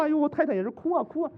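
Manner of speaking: usual speaking voice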